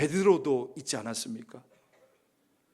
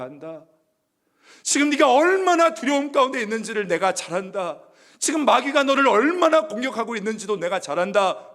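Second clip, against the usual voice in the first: higher, louder pleading love; passionate reassurance; 6 semitones higher, 7 dB louder than usual. Pretend. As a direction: pour out love to the frightened disciple. Jesus reassures Peter passionately. (두려워하는 베드로에게 높고 크게 사랑을 외치는 예수)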